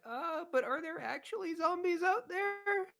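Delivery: high, put-on worried voice; uneasy workers. Game master voices workers still uneasy about zombies, nervous question. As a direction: pitch it up as a nervous crowd member. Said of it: "the workers are still kinda like".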